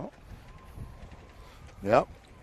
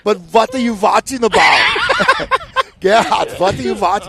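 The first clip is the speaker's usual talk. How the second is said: higher, louder laughing shout, slowing at the end; mock German accent; 9 semitones higher, 7 dB louder than usual. Teasing Uncle Gerhard about the broadcast, loud mock German accent. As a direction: ham up the German uncle joke. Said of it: "He goes".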